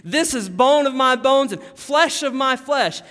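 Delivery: loud, high, full of exclamation points; overwhelmed, exclaiming shout; impassioned exclamation. Acted. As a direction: proclaim it with passion and amazement. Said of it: "that has exclamation points all over".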